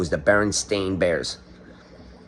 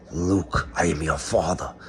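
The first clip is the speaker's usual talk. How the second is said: lightly voiced Darth Vader; 5 semitones lower, 6 dB quieter, slower than usual. Vader line, low and soft.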